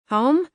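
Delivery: high questioning rise on Home; unsure answer to her boyfriend, rising like a question. A narrator's reading. Scarlet tells Lucas she's home, unsure, rising. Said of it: "the words sounding more like a question".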